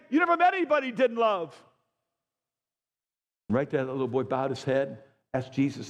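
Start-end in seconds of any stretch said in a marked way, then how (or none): 0.1-1.5 repeated higher and louder, hitting "met"; the line again as a loud sermon peak; preaching climax. Real, not acted. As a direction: punch it out to the room, stressing "met".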